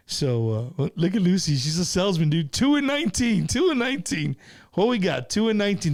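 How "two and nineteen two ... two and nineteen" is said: high, punchy number calls; raffle hype. Real, not acted. Host hyping raffle to viewers, excited higher calls. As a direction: sell the numbers with energy.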